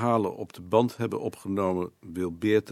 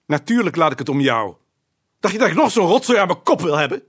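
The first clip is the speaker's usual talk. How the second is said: loud, high, furious retort; shouting in exasperation at his wife; angry outburst; 11 semitones higher, 13 dB louder than usual. Acted. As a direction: snap back at her in open anger. Marital argument, snapping back.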